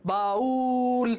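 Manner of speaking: slow "বাউল", the উ sounded in full; fully pronounced. Shown as a demonstration. Teacher proving the vowel is whole, "বাউল" with long উ. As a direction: stretch the উ of "বাউল" on one note. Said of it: "এটা কিন্তু পুরোটা উচ্চারিত হচ্ছে".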